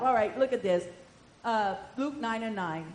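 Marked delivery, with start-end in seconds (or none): none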